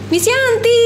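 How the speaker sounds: put-on high sing-song child's call; child calling teacher. Child at the gate greeting teacher, high call. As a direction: call her name like a kid at the gate.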